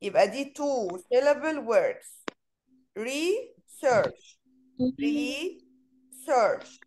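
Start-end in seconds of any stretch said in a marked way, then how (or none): 3.0-4.4 "Research" as re, pause, search; "Research" in two separate syllables for counting; split in two. Shown as a demonstration. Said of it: "هنا two". 5.0-6.8 "Research" as re, pause, search; "Research" in two separate syllables for counting; split in two. Shown as a demonstration. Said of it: "هنا two".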